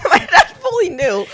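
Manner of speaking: laughing. Real, not acted. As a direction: crack up as you say it. Laughing at dad's trick.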